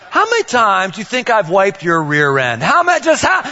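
hammed-up father scolding daughter; mock stern dad. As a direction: lay down the law with playful sarcasm.

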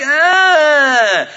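long, high, loud "aah"; dismayed groan. Retold or imitated. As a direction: cry out in drawn-out dismay. Dismayed reaction to Disney plan.

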